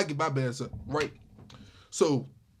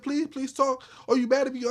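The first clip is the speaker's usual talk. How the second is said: pleading friend voice; 6 semitones higher than usual. Retold or imitated.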